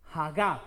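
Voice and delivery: Hagab stressed on the last syllable, ha-GAB; low-pitched Hagab, weight on GAB; stress on final A. Shown as a demonstration. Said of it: "se pronuncia así".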